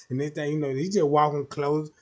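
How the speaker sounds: usual speaking voice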